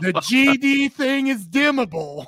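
high, loud shout of disbelief; hammed-up exasperated realization; exasperated outburst. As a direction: shout the punchline in comic outrage. Acts the poster's outraged realization.

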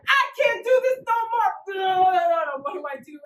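mock crying outburst, high and loud; distressed. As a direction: wail it like you're at your limit. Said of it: "You're like".

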